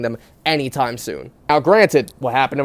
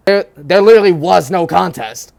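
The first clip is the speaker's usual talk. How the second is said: louder, punching the point; emphatic; 3 semitones higher, 6 dB louder than usual. Real, not acted. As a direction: land the joke hard. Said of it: "emphatic punchline".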